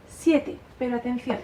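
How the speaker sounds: ordinary talk, nothing marked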